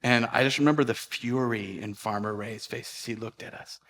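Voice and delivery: ordinary delivery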